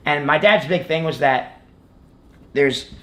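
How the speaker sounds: usual speaking voice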